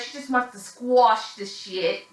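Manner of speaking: a slow, hammed-up New York accent; bad New York accent. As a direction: mimic Gina's New York accent, badly and slowly. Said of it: "That was my worst New York accent ever".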